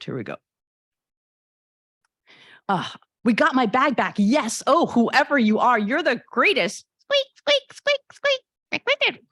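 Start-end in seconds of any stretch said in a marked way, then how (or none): 2.7-6.8 put-on higher, louder gushing teen girl; thrilled to get the bag back; Honey, excited teen. Honey thanks Squeaker for her bag, gushing and excited. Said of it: "pretend I can act". 7.0-9.2 very high squeak noises; squeaky creature. Acted. Squeaker answers Honey, very high squeaks. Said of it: "pretend I can act".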